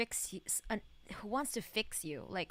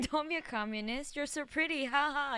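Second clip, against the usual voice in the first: higher mock-gushing read; embracing the cringe; 6 semitones higher than usual. Streamer picking option three to embrace the cringe, higher gushing. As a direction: lean into the cringe. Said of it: "embrace the cringe".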